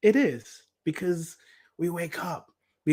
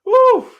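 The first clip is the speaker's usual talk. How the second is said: high loud whoop letting emotion out; joyful high cry; release whoop; 12 dB louder than usual. Real, not acted. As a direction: shake off the tears with a whoop. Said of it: "I'm gonna stop for a minute".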